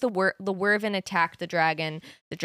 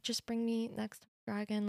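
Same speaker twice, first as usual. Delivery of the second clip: soft dying plea; 2 semitones higher, 11 dB quieter than usual. Retold or imitated. Liam's dying words retold.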